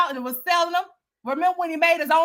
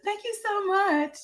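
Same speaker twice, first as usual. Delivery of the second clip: high, sugary mock thank-you; mock-grateful gushing; mocking Olivia; 8 semitones higher, 4 dB quieter than usual. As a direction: gush it back at her in a higher voice. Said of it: "And Olivia's like".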